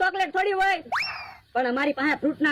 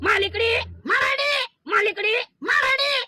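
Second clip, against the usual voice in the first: put-on childish shouted insults; shouted name-calling; 5 semitones higher than usual. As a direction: yell the nicknames back and forth like kids. Name-calling chant between neighbours.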